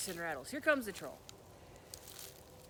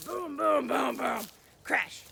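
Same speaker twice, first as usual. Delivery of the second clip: booming, louder stomp sounds; giant footsteps; 3 semitones higher, 9 dB louder, much slower than usual. Acted. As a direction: stomp out the troll's footfalls. Troll stomping in, door crashing.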